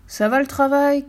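high, loud farmer's call; shouting farmer. A narrator's reading. Lazy farmer hollering to the working duck, shouted question. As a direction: call it out, pitched well up. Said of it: "criait le fermier".

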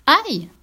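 sharp, loud, high yelp; startled ouch. Acted. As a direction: yelp as something drops on you. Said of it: "cria l'anémone".

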